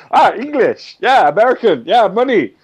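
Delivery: high, loud mock foreign vendor; mock foreign seller spotting a rich American, high and loud; foreign shopkeeper voice. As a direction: ham up a greedy foreign seller, laughing. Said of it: "they go".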